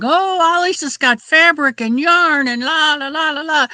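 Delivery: hammed-up excited chatter; mock excited gossip. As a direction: parrot the breathless buzz, trailing into la la la. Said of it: "Everybody's been saying".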